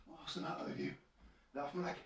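whispered aside to the dog; hushed question; whispering. Real, not acted.

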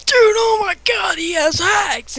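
high, slow mock outrage; imitating a whiny accusation; mock shout. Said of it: "and he was like".

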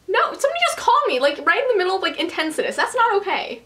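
fast, high-pitched indignation; quick, high, exasperated explaining; indignant. Real, not acted. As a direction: rattle off the complaint, put out. Complaining about the interrupting call.

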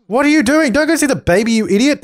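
annoyed yell at a wayward Sim; exasperated scold. Real, not acted. Scolding Sim going to baby.

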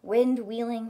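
plain reading voice

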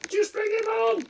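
high squeaky insisting. Acted.

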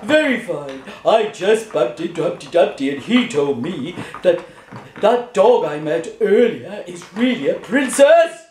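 low, lumbering elephant voice; shy elephant. Acted.

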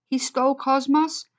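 He stole Cosmos? with a high, disbelieving rise; incredulous question. Acted. Eric learns Graham stole Cosmos, rising in disbelief.

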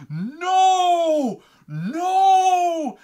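long, high, full-volume howls of protest; hammed-up furious wail; pissed-off screaming. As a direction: imitate an enraged brother screaming. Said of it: "He was so fucking pissed".

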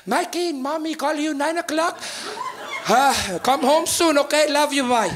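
put-on light, sweet accented mom voice; Portuguese mom. Mother leaving her son a calm nine o'clock voicemail. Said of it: "She'd be like".

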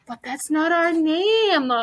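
slow, drawn-out protest; protesting cry. A narrator's reading. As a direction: cry out in mild dismay, stretching it. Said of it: "Francesco cried".

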